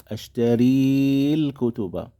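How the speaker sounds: ordinary talk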